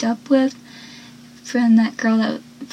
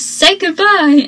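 higher, louder acted-out threat; mock menacing command; 6 semitones higher, 5 dB louder, slower than usual. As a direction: order it like a retold movie villain. Acting out Eddie's threatening line.

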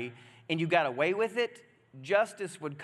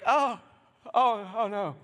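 raised, acted dismay; mock alarm; 4 semitones higher, 4 dB louder than usual. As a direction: play at dawning panic. Acts Paul noticing the snake.

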